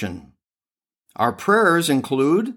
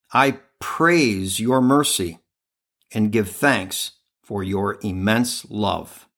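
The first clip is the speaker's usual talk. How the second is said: a hushed, prayerful reading voice; devotional reading; 4 semitones lower than usual. Acted. Podcast host reads a devotional prayer, hushed and prayerful. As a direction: read it as a humble prayer to the Lord.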